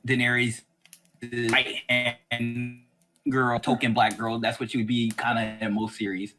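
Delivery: usual speaking voice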